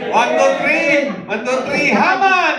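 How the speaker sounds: very loud, high cue to a crowd; shouted count-in. Shown as a demonstration. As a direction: yell the count to rouse everyone. (pasigaw na pagbibilang para sa madla)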